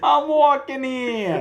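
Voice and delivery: high, slow puppet wail; Kermit. Shown as a demonstration. Echoing friend's frog impression, high and drawn out. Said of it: "How does Kermit sound?".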